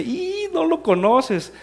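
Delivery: very high, loud, long squeal into the words; imitated mocking laughter. Pastor starts laughing at Antonio, imitated mocking laughter. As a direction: mimic someone cracking up, slow and high-pitched. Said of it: "Y se empieza a reír".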